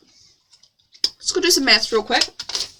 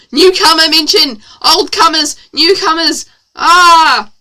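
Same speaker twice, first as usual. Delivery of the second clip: loud, raised-pitch chanting yells; mock excited shouting; 5 semitones higher, 9 dB louder than usual. Reader cheering a word in the story, shouting. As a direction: holler it like a hyped-up fan. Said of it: "Sorry, near the boys".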